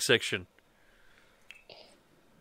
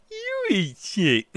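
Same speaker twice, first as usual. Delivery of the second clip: slow, higher mocking drawl; drawn-out taunt; 5 semitones higher, much slower than usual. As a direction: stretch every word to taunt. Repeating the insult, drawn out.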